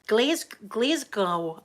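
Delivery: slow American "Glasgow" with "Glez" start. Retold or imitated. Mocking how American lawyers say it, slow American "Glasgow". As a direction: stretch "Glasgow" like an American would. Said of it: "they say Glasgow dead, dead weird".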